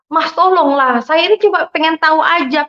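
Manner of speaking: high-pitched, wheedling appeal; retold insistent pleading. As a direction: beg to be let in, retelling it. Insistent plea to be let in.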